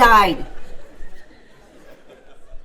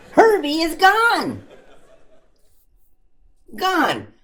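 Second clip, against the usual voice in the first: mock panic; 7 semitones higher than usual. Panic at missing baby.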